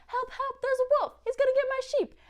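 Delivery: high, loud mock cry for help; shepherd boy shouting to the town for help, high and loud; boy crying wolf. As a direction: call out like a panicked little boy.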